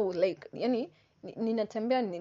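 usual speaking voice

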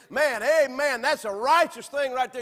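loud, high, delighted "Man, amen"; excited believer. Retold or imitated. Preacher voicing a believer praising at the pulpit, excited. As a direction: burst out with churchy joy. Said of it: "they say".

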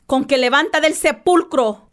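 ordinary talk

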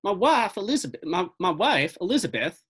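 put-on older local fellow, Southern accent with lively swoops. Older fellow starting his eyewitness account, Southern accent. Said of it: "I'm doing a Southern accent".

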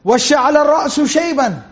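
fast, flowing chanted recitation of the verse; Quran recitation. Acted. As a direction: recite it with tajweed. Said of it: "Zakaria was saying".

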